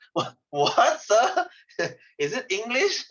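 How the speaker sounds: high-pitched mock bewilderment, laughing; baffled listener. Teacher imitating someone hearing Scottish, high baffled mock voice. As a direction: act totally lost, pitch jumping up. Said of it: "You, you will say".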